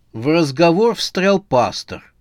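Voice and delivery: ordinary delivery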